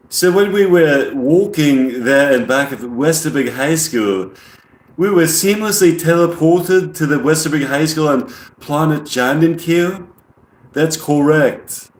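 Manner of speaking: put-on measured English-accented alien; amateur English accent, deliberate and formal. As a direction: imitate an English alien, drawing out the key words. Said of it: "that's a good English accent".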